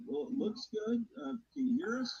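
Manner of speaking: faint delayed echo through a speaker; distant repeat from another device; echo from second device. Real, not acted. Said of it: "There's still an echo".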